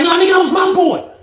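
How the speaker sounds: high-pitched contradiction; arguing back. Retold or imitated. Rob insisting it was his friend, arguing back. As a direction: imitate an indignant counterclaim.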